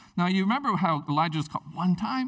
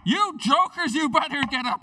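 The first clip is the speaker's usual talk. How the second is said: higher, louder, pushy call; bold demand; 8 semitones higher, 3 dB louder than usual. Acted. Bold man shouting at his sleeping friend, demanding. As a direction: bang on the door and demand it.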